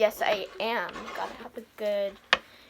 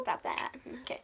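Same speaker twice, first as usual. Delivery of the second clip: sheepish whisper; 3 semitones higher, 11 dB quieter, faster than usual. Real, not acted.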